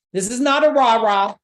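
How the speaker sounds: usual speaking voice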